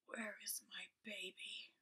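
slow, breathless ghostly whisper; raspy ghost whisper. Acted. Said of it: "spoke in a low, raspy voice".

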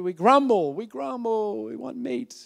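play-acted griping; mock grumbling. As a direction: imitate a crowd whining in chorus. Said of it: "What did they do?".

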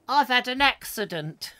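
high squeaky catchphrase voice; Joe Pasquale. Retold or imitated. Host recalls the advert, high squeaky comic voice. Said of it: "Joe Pasquale, yes, that's right".

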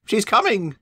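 high, loud glad cry; happy she's spotted; joyful high coach shout; excited call. Acted. Guard spotted running up.

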